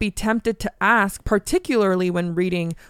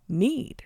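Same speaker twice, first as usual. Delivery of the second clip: "need" set apart after a pause, softer; emphasis on need; 3 semitones higher, 6 dB quieter than usual. Real, not acted. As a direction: isolate the word "need" softly. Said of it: "The key word I'm emphasizing".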